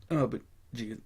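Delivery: words cut by a burp. Real, not acted. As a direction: speak through a small burp. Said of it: "sorry, I just burped".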